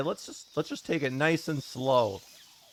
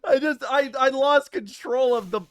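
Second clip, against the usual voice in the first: high, louder whining; half-crying complaint; whiny distress; 9 semitones higher, 5 dB louder than usual. Real, not acted. Losing control, upset.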